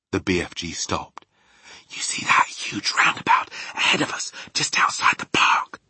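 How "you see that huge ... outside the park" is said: urgent stage whisper. A narrator's reading. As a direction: point out the way in a whisper. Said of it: "Sophie whispered".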